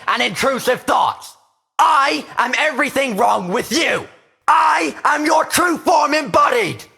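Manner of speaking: slow declarations swelling into a high, loud shout; building menace to shouting. Acted. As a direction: start measured, escalate line by line to a full-throated shout. Inner demon declares itself, building in rage.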